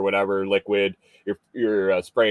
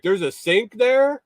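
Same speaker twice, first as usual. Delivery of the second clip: surprised guy; 12 semitones higher than usual. Retold or imitated. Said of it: "he's like".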